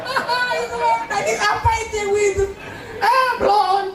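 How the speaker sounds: squeaky high dismissive voice; high mocking scoff. Preacher scoffs at crying over lebaran, high mocking voice. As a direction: brush off the fake tears in a high mock.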